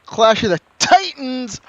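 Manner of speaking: high, loud hammy announcement; loud, high burst of excitement; excited title call. Real, not acted. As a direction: belt out the title with relish.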